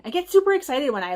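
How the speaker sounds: ordinary talk, nothing marked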